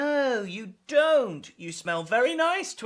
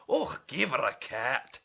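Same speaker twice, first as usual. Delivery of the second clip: grumpy neighbour; 7 semitones lower than usual. Acted. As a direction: lower complaining old neighbour. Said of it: "complained Mister Biggs".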